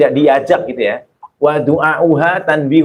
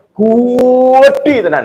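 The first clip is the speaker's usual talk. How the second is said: "Gede tenan" very slow, higher and louder in Surabaya style; exaggerated Surabaya drawl; 9 semitones higher, 4 dB louder, much slower than usual. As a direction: stretch "Gede tenan" hard, Surabaya over the top. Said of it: "itu orang Surabayaan gitu". (logat Surabaya lebay, "Gede tenan" sangat panjang dan tinggi)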